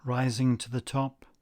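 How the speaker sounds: unhurried guided-relaxation delivery; guided meditation. Acted. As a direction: lead listeners calmly, phrase by phrase, leaving space after each.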